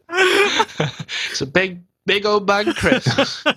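high, slow, laughing; giggling through the words. Real, not acted. Joking jumbo pack.

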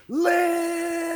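long, high, drawn-out yell; prolonged shout as if heard from outside; Swifty shouting. Acted. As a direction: hold the name in one long scream that fades out. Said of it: "you can still hear him shouting".

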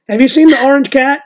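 high, loud asking neighbor. Retold or imitated. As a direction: play the nosy neighbor asking. Neighbors knocking asking about cat.

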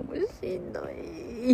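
slow, dragged-out weepy もうしんどい; a hint of crying, stretched out; retelling crying on the walk home; mock tearful. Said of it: "もう泣きながら".

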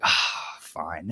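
imitating a reluctant sigh, very soft; resigned sigh. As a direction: breathe out, then give in under your breath. Retold reluctant giving in to visitor.

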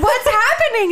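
dad bursting in on a fight, alarmed; shocked dad. Retold or imitated. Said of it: "He was like".

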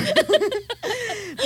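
high burst of laughter. Real, not acted. After joking she fainted, high laugh. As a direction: laugh at your own joke.

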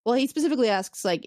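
ordinary talk, nothing marked

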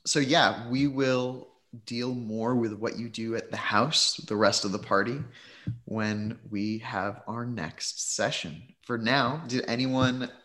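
ordinary delivery

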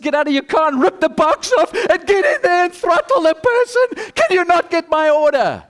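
high, loud frustrated outburst; exasperated customer. Acted. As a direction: shout it as if losing all patience. Acted drive-through customer losing patience.

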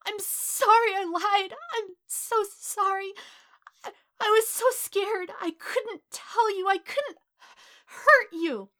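high, drawn-out tearful plea; desperate tearful confession; wretched sobbing. A narrator's reading. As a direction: apologise through sobs, voice high and loud. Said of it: "he sobbed in a wretched breath".

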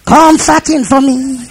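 rhythmic declarations climbing to a long, high final cry; preacher working up the congregation; fired-up preaching. Real, not acted.

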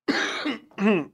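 real coughing fit after choking. Real, not acted. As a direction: cough hard to clear the throat. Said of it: "eu engasguei com".

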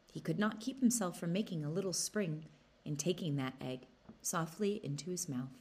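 ordinary delivery

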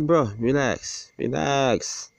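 slow, high, lightly mocking calm-down; mock soothing. Telling the boss to relax, slow and mocking. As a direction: drawl out relax to calm him, teasing. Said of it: "I'm like".

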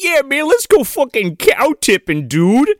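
high, hyped-up dude voice; mock rowdy kid. Kid pitching a joyride, mock dude voice. Voicing one of the kids.

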